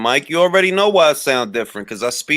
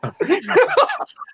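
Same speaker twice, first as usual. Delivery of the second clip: a long high giggle; high rising laugh. Real, not acted.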